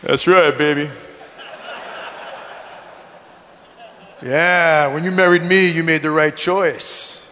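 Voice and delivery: low, self-satisfied husband bragging; puffed-chest swagger. Retold or imitated. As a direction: puff out the chest and croon it proudly. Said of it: "my chest".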